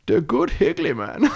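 put-on high, slow, silly Dutch accent; mock Dutch. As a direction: put on an exaggerated Dutch voice on the edge of laughter. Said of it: "say it like Goldmember".

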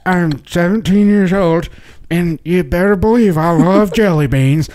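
giving a senior portrait a goofy voice; yearbook voice. Pretend. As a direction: voice a yearbook kid, slow and silly. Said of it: "we would give them voices".

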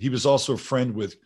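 normal talking voice

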